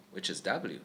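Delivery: ordinary talk, nothing marked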